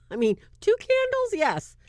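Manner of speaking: squeaky exclaim. Real, not acted. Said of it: "exclaiming about candles".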